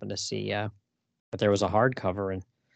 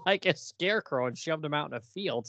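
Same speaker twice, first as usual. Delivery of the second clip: usual speaking voice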